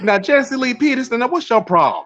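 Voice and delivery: friendly Southern accent impression; [name]'s Southern accent. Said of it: "he sounds just like him".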